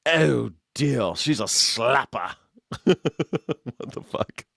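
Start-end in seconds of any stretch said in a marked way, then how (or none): 0.0-2.3 mock English accent.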